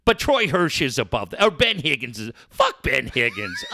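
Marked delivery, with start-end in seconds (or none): 2.5-3.6 angry outburst. Real, not acted. On air, cursing out a rival host, with a low angry shout. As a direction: spit it out at him.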